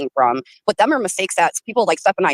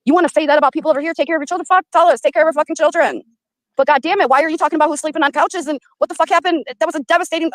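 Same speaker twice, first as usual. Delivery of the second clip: higher, fast, heated rant; getting angry; 7 semitones higher, faster than usual. Real, not acted. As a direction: build into real anger until you cut yourself off. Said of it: "Yeah, I'm getting angry".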